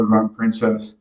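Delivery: usual speaking voice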